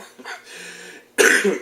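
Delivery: short airy laugh; quick unvoiced chuckle; breathy laugh. Real, not acted. Laughs at own confusion.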